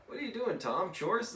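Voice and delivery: high-pitched mock questioning; storybook boy voice. Friend asks Tom about painting the fence, high-voiced. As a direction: pitch it up, teasing and curious.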